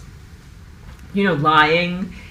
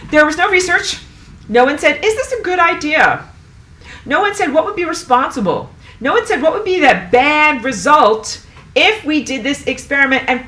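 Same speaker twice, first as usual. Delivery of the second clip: much higher, louder indignant outburst; real anger; 8 semitones higher, 6 dB louder than usual. Real, not acted. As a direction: pile up the accusations, fired up. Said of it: "Can you tell that I'm mad?".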